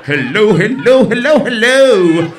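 high, loud, slow hellos to drown it out; loud cover-up. Acted. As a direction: shout over the noise, hammed up. Covering a fart with loud noise.

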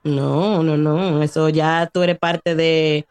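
usual speaking voice

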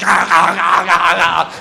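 imitated shark-frenzy noises; repeated open-mouthed chomping barks. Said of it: "thirty sharks just going".

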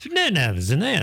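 ordinary talk

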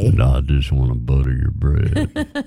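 low, smooth crooner voice. Retold or imitated. Host imitating an ex's deep come-on line.

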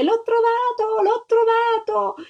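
high, drawn-out shout of joy; triumphant child's singing-out cry; overjoyed cry. A narrator's reading. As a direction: cry out slowly and high, crazy with happiness. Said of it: "si mise a gridare".

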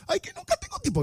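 squeaky, high whining woman's voice; whiny girlfriend. Pretend. Host voices girlfriend complaining he never texts, high whine. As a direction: play the clingy girl who feels ignored. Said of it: "voicing the woman again".

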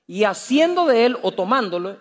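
ordinary talk, nothing marked

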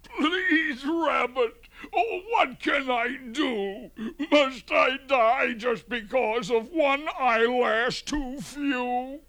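very high, tearful pleading wail; blubbering bear. A narrator's reading. Frightened bear begging the rabbit for help, high blubbering. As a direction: blubber like a terrified, pitiful bear. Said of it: "and he blubbered".

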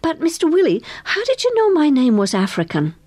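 high-pitched eager question; eager girl questioning a grown-up. A narrator's reading. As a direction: ask keenly, curiosity lifting the voice. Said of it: "she said eagerly".

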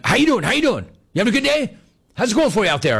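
high-pitched rapid-fire greetings; fast talker. Retold or imitated. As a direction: imitate an aggressive nonstop talker. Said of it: "He's, like, fast".

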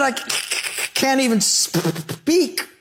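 hard stutter on "c-, c-, can't", in a high voice; high-pitched, halting, acted stammer; stuttering Moses. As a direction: catch repeatedly on the first consonant, straining to get words out. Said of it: "he stuttered. He had a speech impediment".

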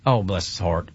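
soft, low, sympathetic "Oh, bless his heart.". Shown as a demonstration. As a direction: say it with real pity, quietly. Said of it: "in context".

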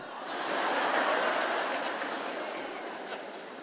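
quiet breathy chuckle; soft laugh. Real, not acted. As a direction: laugh under your breath. Laughing at own joke.

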